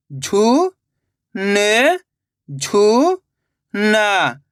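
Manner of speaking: रु-का-व-ट spoken one slow drawn-out syllable at a time. Shown as a demonstration. Teacher sounding out a word for children, syllable by syllable. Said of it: "teacher sounding out syllables".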